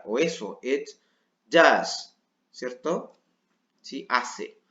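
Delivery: usual speaking voice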